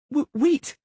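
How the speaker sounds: high, sudden stammered wait; girl calling back the leaving pair; stammered plea. A narrator's reading. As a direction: blurt it, stumbling. Girl calls them back.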